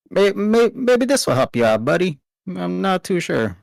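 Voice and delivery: southern accent attempt. Varys offers rag, southern voice.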